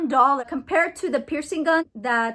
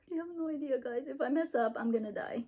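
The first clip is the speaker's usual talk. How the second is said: normal talking voice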